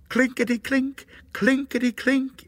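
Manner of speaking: high, crisp rattling coins; high-pitched clinking singsong; imitated coin rattle. A narrator's reading. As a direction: chime it high like jingling coins. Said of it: "it went".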